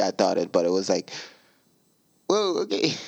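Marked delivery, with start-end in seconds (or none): 2.3-2.9 high-pitched, taken-aback reaction; startled but accepting; non-judgy surprise. Retold or imitated. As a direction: let the pitch jump in retold surprise, without judging. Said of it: "Not, like, in a judgy way".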